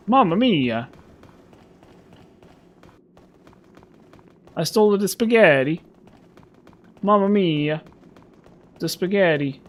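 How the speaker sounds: high, hammy mock Italian accent; Patches impression. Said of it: "That's my Patches impression".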